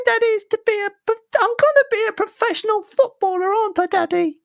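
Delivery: put-on very high-pitched little boy; squeaky pleading kid. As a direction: jump into a put-on child falsetto. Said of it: "I need to do the voice".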